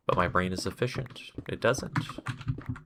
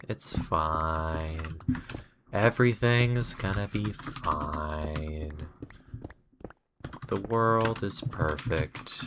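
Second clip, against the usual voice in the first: mock calm; 6 dB quieter, slower than usual. Sarcastic calm denial mantra.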